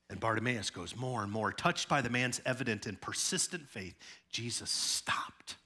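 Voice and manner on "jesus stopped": slow whisper; hushed. Acted. Preacher telling the congregation Jesus stopped, whispered slow. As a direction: breathe it out slowly. Dramatic pause in story.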